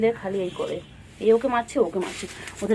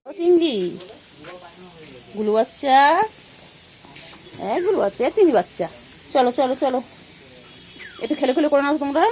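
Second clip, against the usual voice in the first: excited calling; 5 semitones higher, 3 dB louder than usual. Real, not acted. Calling pets to play.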